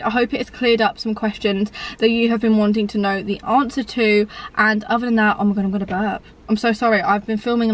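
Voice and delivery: usual speaking voice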